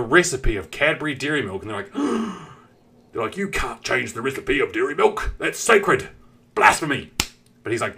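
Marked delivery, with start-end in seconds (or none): 2.0-2.5 high, sharp intake of breath; mock shocked gasp. Chocolate workers hearing of the change, mock horrified gasp. 3.4-7.1 hammed-up scandalised British protest; put-on British voice climbing into indignation; mock outrage. Workers defending the sacred recipe, mock outrage.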